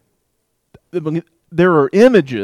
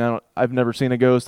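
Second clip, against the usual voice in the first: normal talking voice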